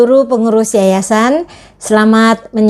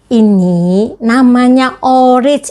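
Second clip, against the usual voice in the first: ordinary talk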